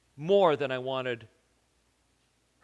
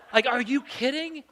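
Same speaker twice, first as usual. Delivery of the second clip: high, laughing disbelief; incredulous; 10 semitones higher than usual. Retold or imitated. Husband scoffing at wife over bone-in chicken, high and laughing. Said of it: "so I said".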